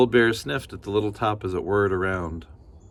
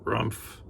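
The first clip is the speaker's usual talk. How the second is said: sleepy bear grunt; 2 semitones higher, 5 dB quieter than usual. Acted.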